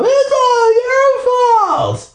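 very slow, high, crying wail; Brandy blaming Mom through tears; crying accusation. Acted. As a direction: drag it out, tearful blame. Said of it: "she glared up at Mom".

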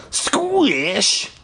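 high squishy sound effect; squelch sound. A narrator's reading. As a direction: spit it out like a squelch. Sound effect word read.

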